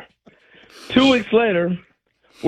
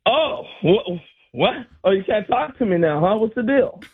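mock offended, higher; imitating Reggie; 6 semitones higher than usual. Reggie miffed that the rookie won't talk, mock offended. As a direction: act hurt at being ignored. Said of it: "And he's like".

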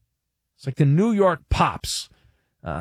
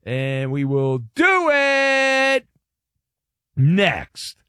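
drawn-out, higher-pitched tease into the break; slow radio tease; 3 semitones higher, much slower than usual. Acted. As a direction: stretch it out to hold listeners. Teases the answer after the break.

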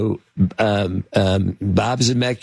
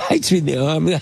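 ordinary delivery

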